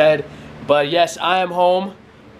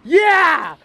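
high, drawn-out hollering; hyped-up cheering; 9 semitones higher, slower than usual. Real, not acted. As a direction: crow it high like a rowdy hype man. Hyped teasing about the dog's mess.

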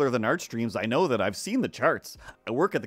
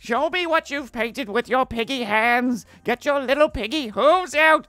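high, loud villain screech; Skeletor; 12 semitones higher, 8 dB louder, slower than usual. Acted. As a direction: shriek insults like a cartoon villain. Skeletor puppet yells insults.

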